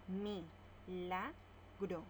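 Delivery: very slow, Mi-la-gro in separate beats; syllable by syllable. Shown as a demonstration. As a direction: spell out Mi-la-gro slowly. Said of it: "Lo separamos en sílabas". (Mi-la-gro sílaba por sílaba, muy lento)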